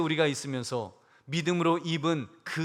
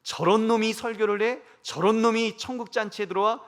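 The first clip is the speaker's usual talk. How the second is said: scornful rebuke; 6 semitones higher, 5 dB louder than usual. Retold or imitated. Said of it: "하나님께서 저를 보신다면".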